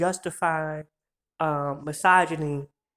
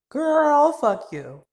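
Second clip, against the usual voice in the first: loud outburst; 10 semitones higher, 5 dB louder, much slower than usual. Real, not acted. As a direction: shout it out high and loud. Sudden outburst at pedophiles.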